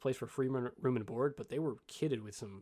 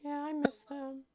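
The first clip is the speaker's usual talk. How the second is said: put-on high little kid voice; small child; 12 semitones higher than usual. Young halfling missing his friends, in a high child voice. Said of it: "this child cannot".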